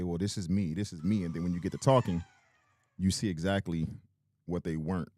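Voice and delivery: ordinary delivery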